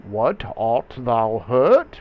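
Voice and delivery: loud, drawn-out alarmed question; theatrical Shakespearean query to a wounded friend; Benvolio. Acted. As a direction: ask slowly, swooping up at the end. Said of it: "Benvolio asks".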